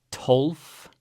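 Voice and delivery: aspirated t, then tolf rising; Icelandic tólf. Shown as a demonstration. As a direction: model tolf for learners. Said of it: "O with an accent makes O".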